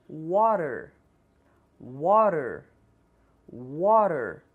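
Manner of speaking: water with the t tapped, like warer; flapped t. Shown as a demonstration. Teacher models water with flapped t. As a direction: tap the t in water lightly. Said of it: "três vezes cada uma".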